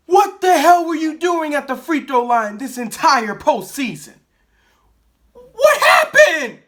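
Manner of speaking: full-throated angry yell. Real, not acted. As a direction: blow up and shout it out. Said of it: "I'm trying not to yell right now".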